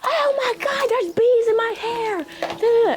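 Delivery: high hammed-up shriek of panic; mock bee-panic wail, very high; panicked scream. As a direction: shriek it way up high like a frantic kid. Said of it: "she started screaming".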